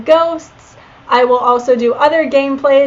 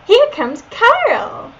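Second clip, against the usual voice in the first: put-on high, slow Valley Girl squeal; bubbly Valley Girl announcement; 10 semitones higher, much slower than usual. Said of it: "I did a Valley Girl voice for Leon".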